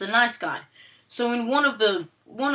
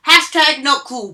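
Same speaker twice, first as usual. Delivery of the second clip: loud hashtag; 6 semitones higher, 11 dB louder than usual. Shown as a demonstration. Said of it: "repeats catchphrase fading".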